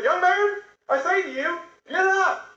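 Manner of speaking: high ringing command; commanding Jesus. Acted. Jesus commanding the widow's dead son, high ringing call. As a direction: call the dead man up with authority.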